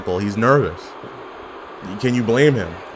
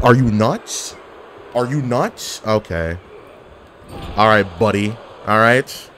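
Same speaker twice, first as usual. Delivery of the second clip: ordinary talk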